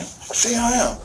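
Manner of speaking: high, tearful imitation of a demand; imitated crying demand. Father mimics his crying son, high tearful voice. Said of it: "but he's, like, crying".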